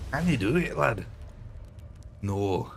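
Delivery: a tentative, lightly done Scottish lilt; a hint of a Scottish accent, tossed off. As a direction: try a quick Scottish "lad" line, half-joking. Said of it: "Can I do Scottish?".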